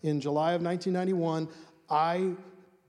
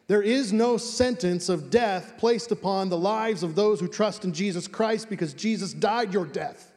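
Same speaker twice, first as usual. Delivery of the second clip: ordinary delivery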